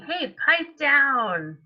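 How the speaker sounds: annoyed neighbor yelling at noisy truck, slow grumbling; grumpy neighbor. Acted. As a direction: tell the noisy truck to pipe down. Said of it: "This person's saying".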